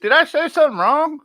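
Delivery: high mock-innocent question. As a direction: play the wounded innocent in a high put-on voice. Mocking the streamer who left.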